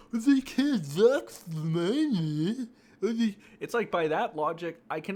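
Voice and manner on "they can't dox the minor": higher, louder and slow mock voice; mocking quote. Mocking those defending doxers, slow high sneer. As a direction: drag it out in a mocking sing-song.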